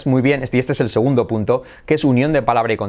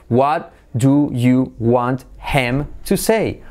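slow "What... do... you... want... him... to... say"; deliberate, unlinked word-by-word question; separate words; much slower than usual. Shown as a demonstration. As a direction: sound out every word from "What" to "say" in full. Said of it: "Por separado".

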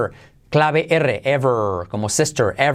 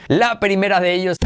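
normal talking voice

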